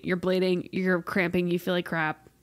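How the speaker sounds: ordinary talk, nothing marked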